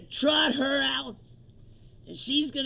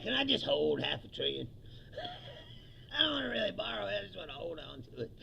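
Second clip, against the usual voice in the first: low voice breaking into laughter; mock Trump, lower and laughing; 3 semitones lower, 5 dB quieter, faster than usual. Comic imitating Trump asking to hold Japan's money. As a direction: plays Trump as a greedy child. Mocking Trump's half-trillion deal.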